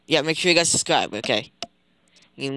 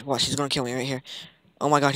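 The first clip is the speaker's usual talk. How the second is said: ordinary talk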